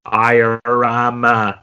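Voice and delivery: put-on halting old Kennedy-style accent; drawn-out vanished Boston accent, imitated; mock Kennedy hemming and hawing. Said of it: "Kennedy or some of those people speaking".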